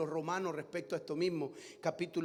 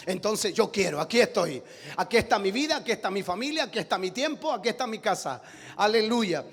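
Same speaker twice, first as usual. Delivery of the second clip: loud, rising pulpit preaching; fiery preaching; 5 semitones higher, 8 dB louder than usual. Acted. Preacher proclaiming to his church, full force. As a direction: preach it hard to the congregation.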